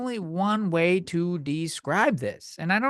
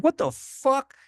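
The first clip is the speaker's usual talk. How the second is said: high-pitched incredulous retort; appalled, pitched way up; outraged disbelief; 9 semitones higher, slower than usual. Retold or imitated. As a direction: blurt it out in acted outrage. Retelling outraged reply to manager.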